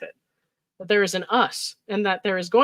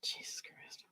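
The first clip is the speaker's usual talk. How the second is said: exasperated whisper; 23 dB quieter, slower than usual. Real, not acted. Overwhelmed reading the texts.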